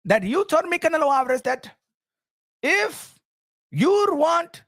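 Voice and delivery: put-on high-pitched, heavy-accented boss ranting; the Turk impression. The Turk scolding Canelo over the Crawford fight, high and ranting. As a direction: lecture Canelo like the man who pays him, rising to shouts. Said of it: "You understand the Turk?".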